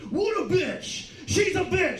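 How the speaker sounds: hammed-up furious rant about the ex-wife; mock angry yelling. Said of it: "I was like".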